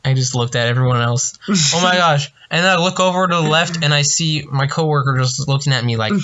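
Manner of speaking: normal talking voice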